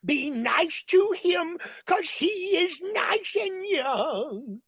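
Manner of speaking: high, slow, quavering diva voice; Carol Channing impression. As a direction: plead like an old stage star. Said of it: "I'm doing impersonations in this show".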